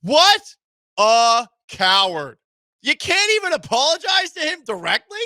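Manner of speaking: incredulous outraged shout. Real, not acted. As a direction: blast him for his cowardice. Host ranting at suspect.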